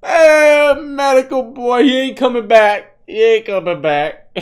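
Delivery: loud, very high mock wail; mocking high-pitched holler. Host mocks the clip's man heading to medical, high mocking holler. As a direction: holler it high and loud, then let it sink a little.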